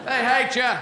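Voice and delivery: sulky bird now openly taunting; defiant mynah bird. Acted. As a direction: say it right out, defiantly. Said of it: "You heard me".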